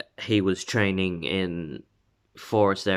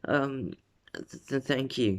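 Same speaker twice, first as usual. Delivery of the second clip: softer, stammered flustered thanks; flustered blushing; 5 semitones higher, 5 dB quieter than usual. Retold or imitated. Uraraka blushes in Deku's arms, shy stammered thanks. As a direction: stammer it shyly, a bit higher. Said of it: "she starts to blush".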